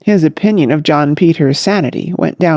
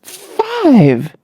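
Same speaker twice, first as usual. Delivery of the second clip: choked, higher strangled gasp of a word; strangled, higher exclamation; 8 semitones higher than usual. A narrator's reading. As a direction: force it out as if throat tight. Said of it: "in a strangled voice".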